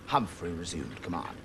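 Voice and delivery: ordinary delivery, nothing marked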